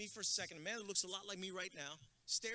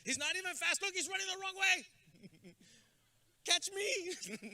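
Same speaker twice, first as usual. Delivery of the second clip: a hint of a taunting kid, higher and louder; mocking kid; 12 semitones higher, 7 dB louder, slower than usual. Jealous boy mocking Junior at field day, lightly voiced. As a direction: tease him, laughing, slow on the running line.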